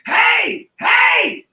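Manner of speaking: high shouted call after them; woman yelling from the shop door; retold calling out. Shop woman calls out to the window washers, loud and high. Woman runs out of gift store.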